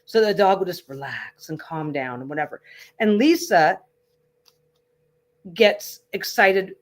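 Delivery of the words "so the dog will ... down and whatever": calm, lowered reassuring voice; low and slow. Shown as a demonstration. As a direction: let the voice sink low to settle a dog. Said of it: "my voice gets a little low and slow".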